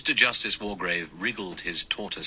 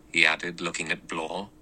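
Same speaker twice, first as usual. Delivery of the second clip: usual speaking voice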